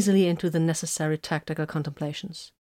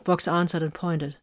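usual speaking voice

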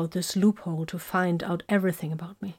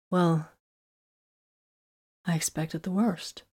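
despondent; 4 dB quieter than usual. A narrator's reading. Woman admitting she expected the worst, despondent. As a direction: let it sink away despondently. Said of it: "she said despondently".

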